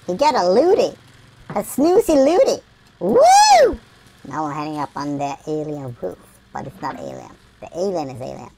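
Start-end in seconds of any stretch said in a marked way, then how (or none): 3.0-3.8 loud, high "woo"; high whoop. Pretend. Player cheering in the game, high loud whoop. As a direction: let out a shrieky whoop.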